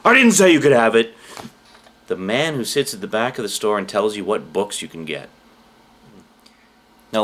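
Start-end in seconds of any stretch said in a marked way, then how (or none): 0.1-1.1 high, loud, bossy man; stern gatekeeper voice. Retold or imitated. Imagined back-of-store man scolding a customer, bossy and loud.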